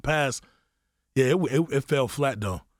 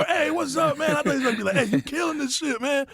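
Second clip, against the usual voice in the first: high, eager mock greeting; imitating an expected friendly hello; hopeful greeting. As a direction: pitch way up, cheery. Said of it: "like, for".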